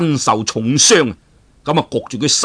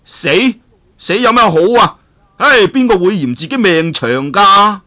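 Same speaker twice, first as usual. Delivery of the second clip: put-on higher, louder playful young hero, joking about death; Duan Yu bantering; 6 semitones higher, 4 dB louder than usual. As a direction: stall for time with a teasing quip. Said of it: "於是就話啦". (段譽輕佻說笑，聲調揚高)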